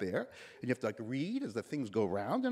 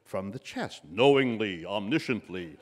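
broadcaster chest voice; 5 semitones lower, 5 dB louder than usual. Retold or imitated. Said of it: "supposed to speak like Walter Cronkite".

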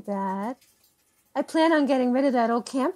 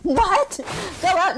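shocked what; 7 semitones higher, 3 dB louder, slower than usual. Acted. Jane shocked at the woods suggestion.

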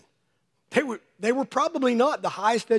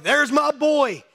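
slow, tender pride; proud God; 6 semitones higher, 5 dB louder, much slower than usual. Retold or imitated. God gazing at a newborn, tender and proud. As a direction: say it like a beaming father.

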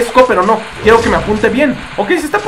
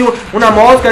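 normal talking voice

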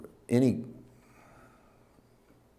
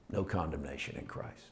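hushed quote; 3 semitones lower, 10 dB quieter than usual. Retold or imitated. Quoting Paul, hushed.

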